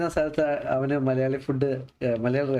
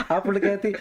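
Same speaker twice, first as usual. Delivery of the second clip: normal talking voice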